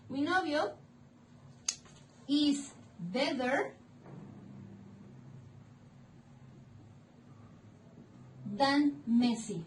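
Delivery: slow modelling. Shown as a demonstration. Teacher building an English example for students, slowly.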